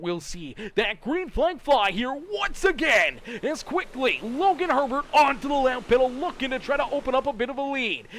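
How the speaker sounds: loud, high, driving race call; live play-by-play. Real, not acted. Announcer calling the green flag and first pass, excited play-by-play. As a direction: call the restart at full force.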